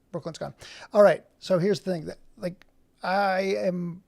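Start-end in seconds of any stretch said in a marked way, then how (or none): 0.1-0.5 softer, falling echo to yourself; quiet repeat. Real, not acted. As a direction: say it again under your breath. Repeats it to himself.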